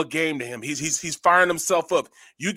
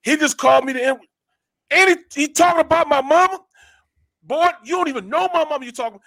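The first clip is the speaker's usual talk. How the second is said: retold indignant outrage; 7 semitones higher, 4 dB louder than usual. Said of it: "all he talked about was God".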